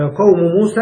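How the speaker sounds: usual speaking voice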